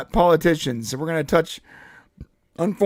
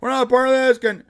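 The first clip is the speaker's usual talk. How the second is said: meek husband; 7 semitones higher than usual. Retold or imitated. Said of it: "He's like, literally".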